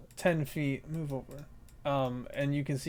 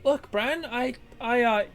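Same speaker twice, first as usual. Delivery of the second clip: a bit higher, hesitant; lightly voiced sheepish harpy; 9 semitones higher than usual. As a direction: explain awkwardly. Clint voice explains sheepishly.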